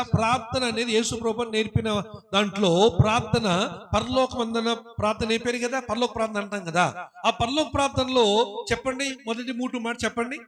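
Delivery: usual speaking voice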